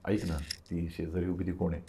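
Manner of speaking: hushed, low-volume question spoken close to the ear; whispered in his ear. Retold or imitated. Mother asking the brother quietly at a wedding. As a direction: ask it quietly so no one nearby hears. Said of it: "कानात विचारलं की".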